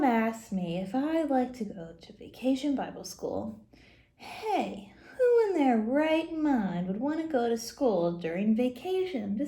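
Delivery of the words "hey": ordinary delivery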